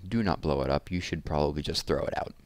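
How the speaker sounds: ordinary delivery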